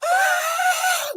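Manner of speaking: very high-pitched, sustained scream; shrieking pig tantrum. Acted. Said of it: "So he starts screaming".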